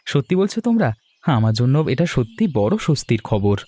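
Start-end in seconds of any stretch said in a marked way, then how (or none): 0.1-0.9 pitched up high in glad disbelief; surprised. Acted. Brother of the bride, hearing the sisters have roses, surprised. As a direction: ask it as if you can't believe the luck.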